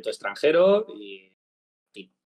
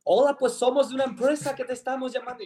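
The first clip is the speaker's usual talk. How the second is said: much higher, sugary sales voice; mock call-centre caller; 11 semitones higher than usual. Retelling a scam call to a friend, hammed-up caller voice. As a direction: play the pushy caller, pitched way up.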